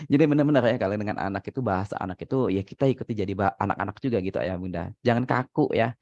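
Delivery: usual speaking voice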